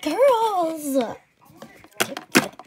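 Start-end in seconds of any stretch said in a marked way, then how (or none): 0.0-1.0 loud, high call across the room; shouting for the girls. Acted. Calling the girls loudly.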